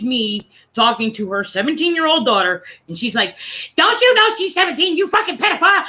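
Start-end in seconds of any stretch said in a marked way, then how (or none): 3.8-5.9 high, shrieky mock outrage; screaming mother. Mother catching a stranger chatting with her daughter, mock screaming. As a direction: imitate an outraged mother's screech. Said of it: "she just starts screaming at me".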